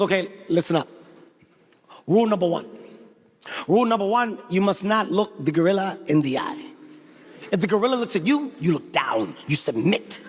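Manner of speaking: low, measured, commanding tour guide; Antoine. Retold or imitated. Tour guide instructing visitors on gorilla rules, low and solemn. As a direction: lay down the rules in a deep, solemn voice. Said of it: "This is Antoine's exact words".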